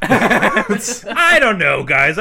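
drawn-out laughing protest. Real, not acted. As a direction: laugh through the words. Laughing mock defense.

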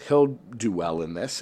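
usual speaking voice

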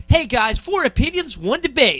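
high, loud TV host hype; show host intro. Shown as a demonstration. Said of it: "'cause I do the".

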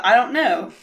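normal talking voice

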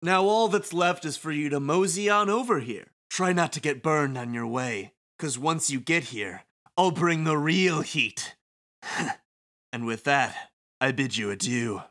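showy, facetious villain host; theatrical taunting announcer. Acted. Game villain invites the Wicked Twisters over, theatrical taunting announcer. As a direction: toy with the listeners, savoring the threat.

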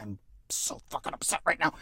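hushed, barely contained glee; mock-upset whisper. Grinning Borsk hearing of the Sith, gleeful whisper. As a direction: whisper it as if secretly delighted.

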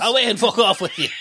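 high, laughing Irish dad snapping back; angry Irish father. Acted. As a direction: tell the kid off in a thick Irish accent. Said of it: "Irish father".

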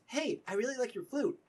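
high, eager character voice; friendly, awkward Dylan. Acted. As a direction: pay a shy compliment, then ask. Game master plays Dylan complimenting the flute.

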